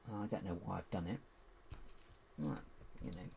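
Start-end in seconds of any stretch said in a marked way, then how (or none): none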